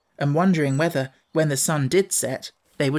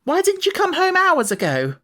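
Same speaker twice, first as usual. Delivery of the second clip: high, louder scolding question; scolding servant; 4 dB louder than usual. A narrator's reading. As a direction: demand why they're late. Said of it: "scolded by Martha".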